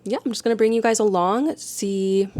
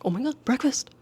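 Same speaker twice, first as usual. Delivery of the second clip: lightly voiced pet, higher pitched; mock pet excitement, pitched up; pet's voice; 6 semitones higher, 4 dB quieter, faster than usual. Pet reacting to owner getting up, higher mock-excited voice. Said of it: "and he's like".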